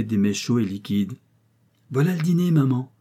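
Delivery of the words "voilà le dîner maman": enfant joyeux qui s'écrie, bien plus aigu. Acted. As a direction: annonce ravie en entrant, voix haute. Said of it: "s'écria-t-il en entrant". (le petit garçon annonce joyeusement le dîner à sa mère, voix aiguë)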